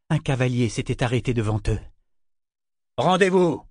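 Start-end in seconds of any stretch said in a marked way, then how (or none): 3.0-3.6 raised, forceful command. A narrator's reading. Horseman halts the coach, commanding surrender. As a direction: call out a demand to surrender. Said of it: "commanda-t-il".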